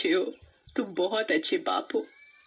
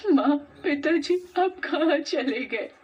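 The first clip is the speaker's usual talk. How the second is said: crying; 7 semitones higher than usual. Acted. Pooja cries to her dead parents after being bullied. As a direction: cry out to your lost parents. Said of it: "रोने लगती है".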